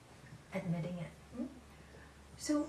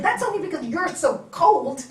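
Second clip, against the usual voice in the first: loud, higher retort; retold retort; 6 semitones higher, 14 dB louder than usual. Wife hitting back in the argument, loud. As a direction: fire it back at him. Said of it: "And I said".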